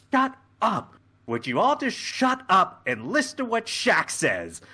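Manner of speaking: high-pitched exasperated plea for silence; exasperated outburst. Acted. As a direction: burst out over everyone's chatter. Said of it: "Boimler stands up and just goes".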